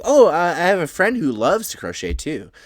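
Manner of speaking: high, loud burst of enthusiasm; acted delighted interest; eager. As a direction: chime in excitedly on common ground. Gremlin finds a shared interest.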